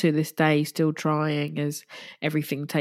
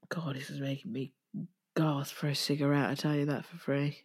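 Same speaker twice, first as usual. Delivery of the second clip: much softer, half whispered, drained; worn-out murmur; 2 semitones lower, 8 dB quieter than usual. Real, not acted. Host craving a cigarette after crying, drained murmur. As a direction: let it fall away into a weary near-whisper.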